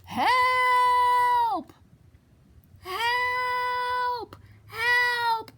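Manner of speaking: high, drawn-out storybook cry; cried-out plea. A narrator's reading. Stuck little blue truck to friends, high crying call. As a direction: call out long and high for rescue. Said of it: "cried the little blue truck".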